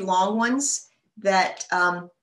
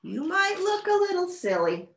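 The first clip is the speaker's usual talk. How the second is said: Goose voice; 10 semitones higher than usual. Acted.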